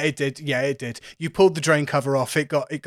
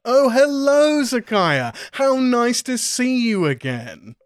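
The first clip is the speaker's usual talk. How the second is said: high, loud, drawn-out put-on greeting; effusive character greeting; 9 semitones higher, 4 dB louder, slower than usual. Game master as Lintu greets Zakaya, effusive high character voice. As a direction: sing out a slow, high, delighted welcome.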